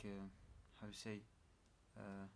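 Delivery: ordinary talk, nothing marked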